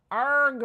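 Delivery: loud, high frustrated "arg"; frustrated growl. Real, not acted. Player stuck without agate, groaning in frustration. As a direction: groan it out loudly.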